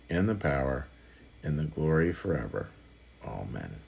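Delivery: soft, measured recitation of the Lord's Prayer. Acted. Pastor praying with the congregation, soft and reverent.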